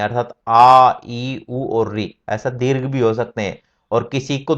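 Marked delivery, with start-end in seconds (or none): 0.0-2.1 आ ई उ री each held for two beats, slow; slow long-vowel demonstration. Shown as a demonstration. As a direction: stretch every vowel to double length. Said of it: "दो मात्रा वाले भी हो सकते हैं".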